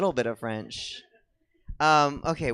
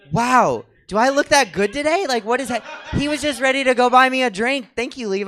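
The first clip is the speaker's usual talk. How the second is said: excited; 10 semitones higher, 9 dB louder than usual. Real, not acted. Flattered by drink offer.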